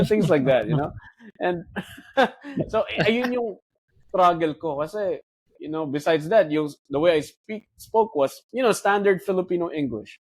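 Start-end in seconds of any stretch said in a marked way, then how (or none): none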